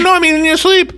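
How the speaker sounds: high, insistent correction; exasperated. Retold or imitated. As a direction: explain it in disbelief.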